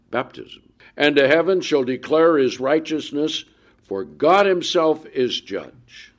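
solemn booming scripture read; preaching scripture. Acted. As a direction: proclaim it to the congregation. Reads psalm aloud from pulpit.

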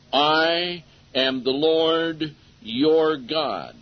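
each word drawn out slowly and weightily; slow emphatic ending. Shown as a demonstration. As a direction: lean on every word, very slow. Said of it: "Specific, dogmatic, and emphatic".

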